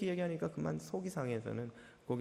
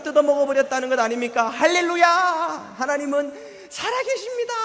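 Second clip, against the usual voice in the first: ecstatic rejoicing; 7 semitones higher, 10 dB louder than usual. Retold or imitated. Deacon rejoicing, dancing with joy.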